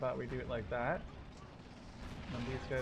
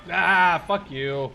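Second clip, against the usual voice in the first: loud, sharp shout at the enemy; angry yell; 3 semitones higher, 15 dB louder, much slower than usual. Real, not acted. Gamer yelling at an enemy gun, loud and furious. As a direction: yell it straight at the gun that just hit you.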